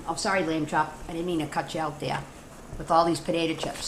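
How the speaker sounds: ordinary talk, nothing marked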